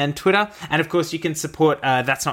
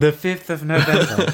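train station announcement; 3 semitones higher, 4 dB louder than usual. Pretend. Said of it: "like a train station announcement".

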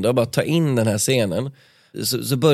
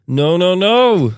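much higher, loud desperate yell; screaming no; 8 semitones higher, 7 dB louder, much slower than usual. Acted. Carl Philip shouting at his attacker, loud desperate yell. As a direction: yell it while fighting back. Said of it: "skrek Carl Philip".

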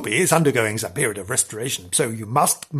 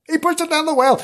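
shocked; 14 semitones higher, faster than usual. Acted. Shocked repeat of the story.

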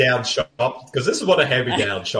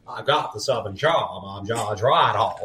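softer, stretched-out mock New Orleans accent; Judge Reinhold; 4 dB quieter than usual. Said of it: "he's attempting a New Orleans accent. It's like".

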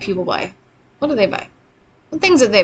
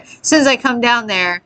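ordinary talk

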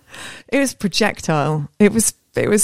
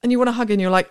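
ordinary talk, nothing marked

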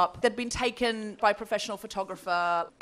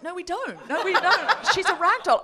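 laughing protest; 6 semitones higher than usual. Retold or imitated. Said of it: "And I was like".

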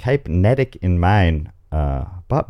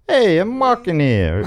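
New Jersey accent; 10 semitones higher than usual. Shown as a demonstration. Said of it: "I don't know if I know that New Jersey accent".

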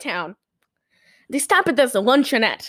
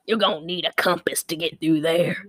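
lower-pitched impression of a bus driver; Ernest Borgnine; 7 semitones lower than usual. Bus driver warning a city traveler, low character voice. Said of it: "Ernest Borgnine's like".

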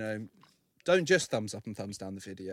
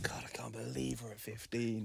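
unvoiced amazed whisper; 10 dB quieter than usual. Real, not acted. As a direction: breathe it in disbelief. Whispered aside about episode count.

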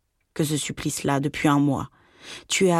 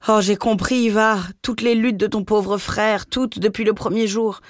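distraught; 6 semitones higher, 3 dB louder than usual. Acted. Mother's distressed confession.

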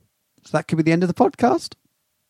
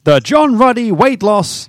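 mock ad title; 7 semitones higher, 4 dB louder, slower than usual. Mock announcing a product title.

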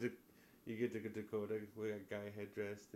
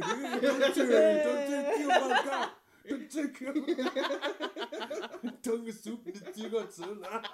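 high, laughing squeal of a talking soup; 11 semitones higher, 7 dB louder than usual. Pretend. Joking with friends, voicing the soup as a high laughing squeal.

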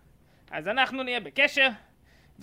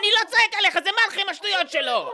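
louder, higher protest; denying shouting; 9 semitones higher, 4 dB louder than usual. Acted. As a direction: insist you're not yelling while getting louder. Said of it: "אתה צועק עליי". (מכחיש שהוא צועק בקול רם)

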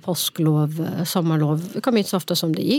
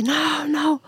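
imitated child's scream; 9 semitones higher than usual. Mother imitating son as he is carried off, mock scream. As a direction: imitate a protesting child, pitched way up. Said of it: "han skriker".

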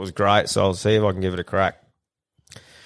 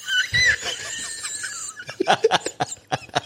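long high laugh; 4 dB quieter than usual. Real, not acted. Punchline of his own joke.

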